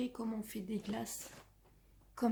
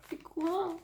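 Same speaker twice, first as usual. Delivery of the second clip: higher, louder playful protest; mock indignant; 5 semitones higher, 5 dB louder than usual. Real, not acted. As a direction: act outraged in fun. Mock complaint about missing answer.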